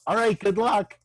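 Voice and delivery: high, loud offhand send-off; casual goodbye. Retold or imitated. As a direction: toss it off breezily. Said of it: "And he just goes".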